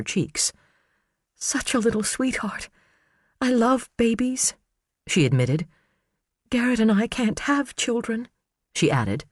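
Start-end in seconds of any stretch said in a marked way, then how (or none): 1.4-4.5 high, soft, teary voice; fighting back tears; tearful. Acted. Tearful Karen remembering the baby she minded, soft and teary. 6.5-8.3 fighting back tears; tearful. Acted. Karen ashamed.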